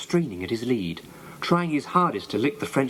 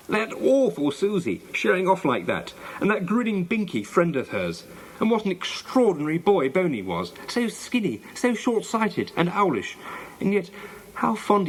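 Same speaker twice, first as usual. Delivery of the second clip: indignant; 7 semitones higher than usual. A narrator's reading. Janet's cross thoughts while marching off.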